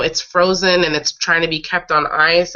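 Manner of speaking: ordinary talk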